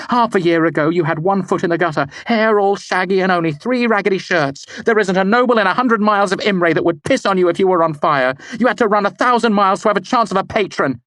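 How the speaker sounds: very high, loud, fast scorn; angry tirade. A narrator's reading. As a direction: tear him down.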